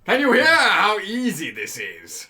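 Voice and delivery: slow, loud teasing sing-song; teasing drawl. Acted. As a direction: stretch each word to tease. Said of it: "repeat of the tease, drawn out".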